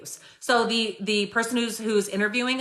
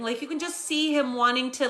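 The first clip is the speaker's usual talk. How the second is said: ordinary talk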